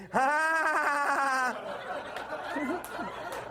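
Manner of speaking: high, drawn-out laugh; hammed-up evil laugh; villain cackle. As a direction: gloat like a villain.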